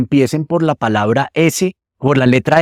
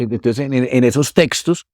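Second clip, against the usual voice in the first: ordinary delivery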